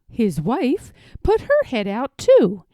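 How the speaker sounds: usual speaking voice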